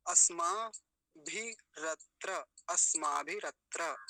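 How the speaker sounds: अस्माभिरत्र broken into slow syllables, asmaa, bhi, rat, ra; separated r and tra. Shown as a demonstration. Teacher showing both sounds are separate, slow syllables. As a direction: stretch अस्माभिरत्र, keeping each cluster distinct. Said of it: "ऐसे".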